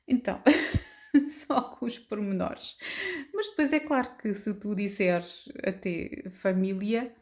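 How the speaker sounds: ordinary talk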